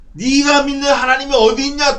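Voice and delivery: loud, high-pitched challenge; acted mocking demand; taunting enemy. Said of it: "또 이러는 거예요".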